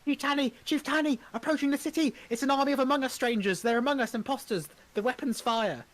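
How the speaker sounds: high, loud panicked warning cry; alarmed messenger. Acted. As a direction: shout the alarm like a frantic herald. Messenger warns of attacking army.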